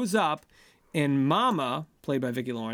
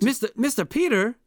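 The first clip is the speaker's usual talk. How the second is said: put-on high, loud housekeeper accent; put-on accent as Dora; 8 semitones higher, 5 dB louder than usual. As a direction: call out in Dora's put-on accent, pitched high. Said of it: "I'm doing an accent".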